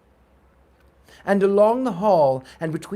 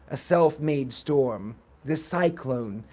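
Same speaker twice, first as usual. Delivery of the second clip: ordinary delivery, nothing marked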